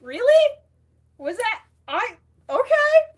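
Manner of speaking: high, loud disbelief; real surprise. Real, not acted. Streamer hearing her accent praised, loud high surprise. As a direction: squeak it out in astonishment.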